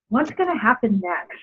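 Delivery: ordinary talk, nothing marked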